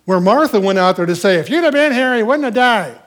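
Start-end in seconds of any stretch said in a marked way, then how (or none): 1.3-3.0 loud, high-pitched accusation; indignant, raised-voice reproach; chewing him out. Acted. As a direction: scold him as if it's his fault. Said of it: "she didn't chew him out like her sister".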